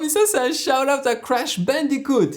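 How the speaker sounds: high, loud excited yell; thrilled shout. Real, not acted. Reconnaissant le clin d'œil à Crash Bandicoot, il crie de joie. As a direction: cry out in delight at the reference.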